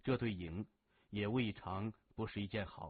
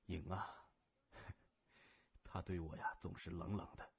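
put-on lower, softer, rueful older brother with a small laugh; a wistful man half laughing at himself; lightly voiced wounded leader, low and soft; Gao Zhen, lightly voiced; 7 semitones lower, 11 dB quieter than usual.

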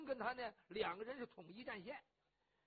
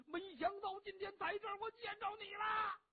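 high wailing sob, much higher and louder; weeping reunion cry of a rough hero; 11 semitones higher, 4 dB louder than usual. A narrator's reading. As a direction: bawl with joy at the reunion. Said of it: "咧着嘴就哭".

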